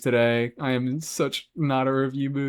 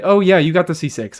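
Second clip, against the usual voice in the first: surprised; 6 semitones higher, 6 dB louder than usual. Real, not acted. Suddenly remembering a viewer's C6.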